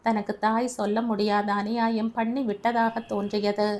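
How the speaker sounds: ordinary delivery, nothing marked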